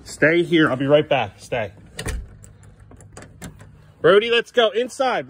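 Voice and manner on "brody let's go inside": calling out. Real, not acted. Calling the dog back inside.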